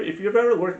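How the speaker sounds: ordinary delivery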